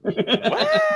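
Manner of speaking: very high, loud squeal breaking into laughter; shocked outburst. Acted. Tarot reader replaying own reaction, laughing mock shock.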